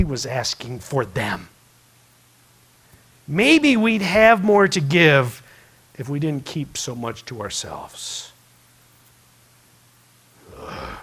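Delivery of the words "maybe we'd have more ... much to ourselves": slow, pressing it home, then dropping; slow emphatic point. Acted. Preacher pressing the point to the congregation, slow and emphatic. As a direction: land the lesson slowly and heavily.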